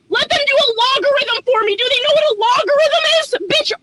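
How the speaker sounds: ranting shout at a customer who won't wait; furious shout. Pretend. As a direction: let it all out at the customer, yelling. Imagined rant at rude customer.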